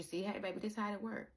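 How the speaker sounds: soft, quiet voice of God explaining; hushed knowing divine voice; calm God voice. Retold or imitated. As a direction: gently show them how it works. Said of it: "And God shows you".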